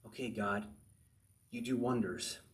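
low, hushed musing; quiet, pondering prayer with pauses; meditative self-talk. Acted. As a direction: think it over softly to God. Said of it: "he's meditating upon it".

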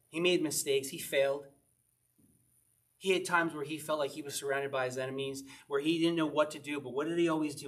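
ordinary talk

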